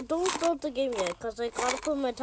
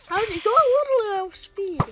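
surprised; 9 semitones higher, 8 dB louder than usual. Real, not acted. Shocked at opponent.

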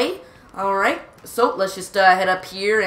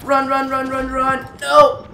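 rising panic, higher and louder; panicked shouting; 5 semitones higher, 4 dB louder, slower than usual. Real, not acted. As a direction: yell at yourself to run. Panicked fleeing enemies in game.

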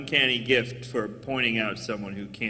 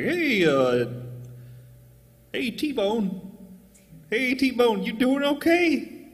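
higher, slowish worried voice; higher-pitched concerned friend; 9 semitones higher, slower than usual. Retold or imitated. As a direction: ask gently, worried for him. Worried friends check on him.

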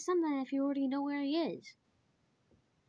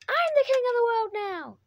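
triumphant cry; 6 semitones higher, 5 dB louder than usual. Real, not acted. Triumphant shout in game.

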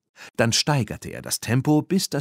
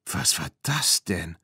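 lower, baffled question; 4 semitones lower than usual. A narrator's reading. Claire after seeing the hex, baffled. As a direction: ask with understated astonishment. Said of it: "fragte Claire verblüfft".

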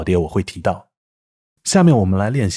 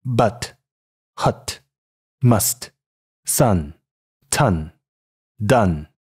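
American English but, hut, must, sun, ton, done, central "uh" vowel; 美式英语读音; much slower than usual. Shown as a demonstration. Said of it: "美式英语". (用美式英语逐词示范，元音靠近“呃”)

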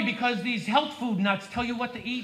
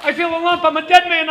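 louder, higher, alarmed; hammed-up panic; 5 semitones higher, 7 dB louder than usual. Comic fakes terror at finding a lump. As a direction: act out a comic lump scare.

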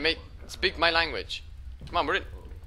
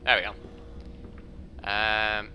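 normal talking voice

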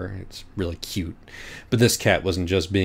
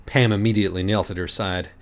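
ordinary delivery

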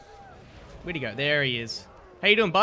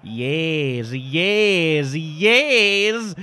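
rising, louder triumphant yeses; excited yes; 4 dB louder, much slower than usual. Real, not acted. Gamer cheering his win in Witcher, building excited yes. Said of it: "Sorry, I got excited there".

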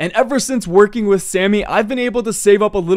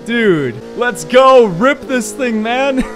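higher, drawn-out cheer for the ride; excited hype; 3 semitones higher, slower than usual. Pretend. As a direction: hype it up, stretching the words. Hyping up a fast car ride.

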